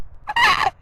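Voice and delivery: a sudden high laugh; surprised squeal of laughter. Real, not acted. Laughs at the cat.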